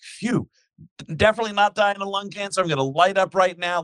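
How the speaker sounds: relieved smug agent. Retold or imitated. Relieved agent mocked in retelling.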